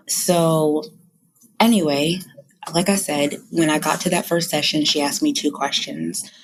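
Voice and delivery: usual speaking voice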